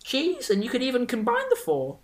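put-on lightly squeaky teen server; a hint of a cracking boy voice. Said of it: "voice hasn't quite settled yet".

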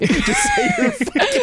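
high squeaky laughing quote; cracking up while quoting a friend; laughing imitation. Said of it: "All I could hear is Robert saying".

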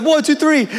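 high, quick hyped count; retold team countdown. Teammates counting down to the cheer, hyped.

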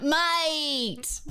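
high, drawn-out Bogan Australian call; Bogan accent. Shown as a demonstration. Showing the co-hosts how Bogans talk, Bogan accent. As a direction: holler it in a broad Bogan accent. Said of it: "how to do a Bogan accent".

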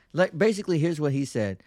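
normal talking voice

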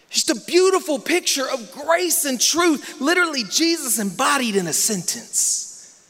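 full-force sermon peak; preaching at full force. Acted. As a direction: lift the church with each phrase. Preaching climax on grace and truth.

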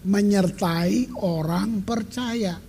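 slow, deliberate re-reading of "Menyertai orang percaya"; "Menyertai orang percaya" read very slowly, word by word; careful reading. Shown as a demonstration. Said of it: "Baca baik-baik".

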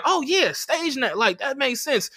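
high, soft, slow mock agreement; imitated easy approval. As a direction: mimic people who readily accept it. Voices approving outsiders.

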